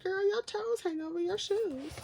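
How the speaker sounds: high, teasing sassy voice; sassy girlfriend voice. Pretend. One drawn girl teasing the other, high and sassy. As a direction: roast her friend, playful and high. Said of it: "And she's like".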